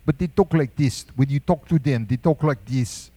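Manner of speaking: imitated Ghanaian English; Ghanaian accent. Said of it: "they speak English as a primary language in Ghana".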